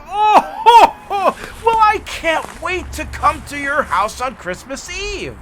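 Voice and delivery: high cheerful Santa ho ho; happy jolly Santa. Retold or imitated. Talking to kids on video, happy jolly Santa. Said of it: "Happy Jolly Santa".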